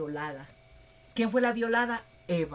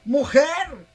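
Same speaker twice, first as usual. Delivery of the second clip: loud, higher shout of Mujer; calling out loud; 6 semitones higher, 10 dB louder than usual. Pretend. The idol calls out to the kneeling woman, loud. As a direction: call the woman sharply.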